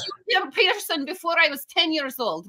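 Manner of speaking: put-on high-pitched, boastful Russian accent. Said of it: "started speaking in a Russian accent".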